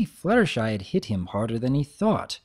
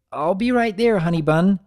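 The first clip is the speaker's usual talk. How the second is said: higher, louder eager husband; Mister Cake voice; 6 semitones higher, 5 dB louder than usual. Acted. Husband answering his wife, high and loud. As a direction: call back brightly to the kitchen.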